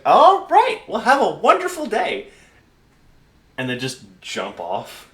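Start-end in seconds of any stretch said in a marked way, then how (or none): 0.1-2.3 very high, loud official sign-off; high-pitched cheery bureaucrat; chipper admin. Acted. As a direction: dismiss them with over-bright politeness. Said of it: "the admin just looks down and goes".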